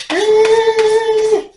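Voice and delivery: loud, high startled yell; angry shout. Retold or imitated. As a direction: snap at the old woman. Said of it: "kind of like".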